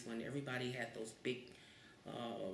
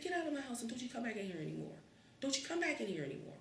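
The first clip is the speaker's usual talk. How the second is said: high, fast mock scolding; angry mother; 9 semitones higher, faster than usual. Mother banning the kid from the house, fast mock anger. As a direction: rattle it off like an angry mom.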